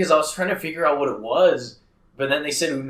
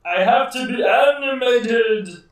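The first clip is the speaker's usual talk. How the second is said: exaggerated animated delivery, slow and higher; mock animated; 10 semitones higher, 3 dB louder than usual. Friend urging more life, hammed-up animated voice. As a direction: overplay it like a stage actor. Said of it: "Maybe you just gotta be more animated".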